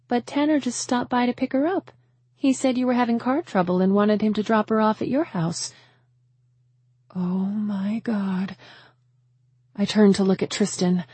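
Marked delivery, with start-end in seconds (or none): 0.1-5.7 higher-pitched confused woman's voice; puzzled friend. A narrator's reading. Friend explains who collected the child, puzzled. 7.1-8.6 slow, drawn-out horrified realisation; dawning horror. A narrator's reading. Mother learns her child was taken, dawning horror.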